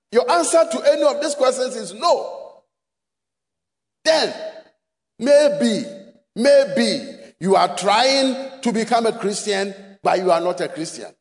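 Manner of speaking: ordinary talk, nothing marked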